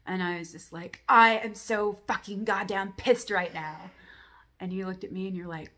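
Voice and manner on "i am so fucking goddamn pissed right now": loud, slow, furious declaration; furious outburst. Retold or imitated. Telling Kelly in the dream how furious she is, slow loud shout. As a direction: spit out every word in a rage. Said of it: "I tell her how fucking pissed I am".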